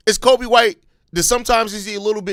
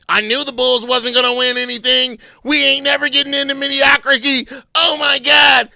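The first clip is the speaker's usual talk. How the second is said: loud, higher-pitched mock despair; put-on wailing complaint; mock whining fans; 6 semitones higher, 6 dB louder than usual. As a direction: imitate fans complaining bitterly. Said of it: "Over here whining about the wrong shit".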